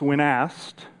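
ordinary talk, nothing marked

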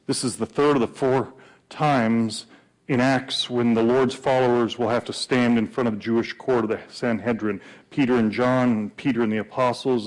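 ordinary delivery

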